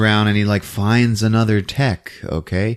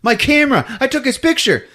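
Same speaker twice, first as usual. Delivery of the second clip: high-pitched frantic cry; mock distressed high voice; frantic woman; 12 semitones higher than usual. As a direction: imitate a woman urgently exclaiming. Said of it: "And she's like".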